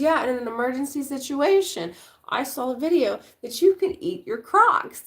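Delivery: put-on high, loud kid voice; eager kid sharing a discovery; excited child voice. Daughter voiced again.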